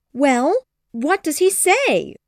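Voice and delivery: can't wait to hear, eager; eager cry. Acted. Mother to Jane, high eager demand. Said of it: "cried Missus Bennet".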